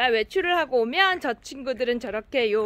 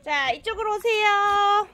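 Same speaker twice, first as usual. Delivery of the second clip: coaxing a pet; 7 semitones higher than usual. Real, not acted. Coaxing the cat over.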